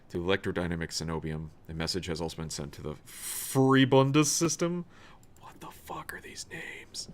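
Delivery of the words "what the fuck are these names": slow whispered aside; whispering. Real, not acted. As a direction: whisper it under your breath. Aside whisper during reading.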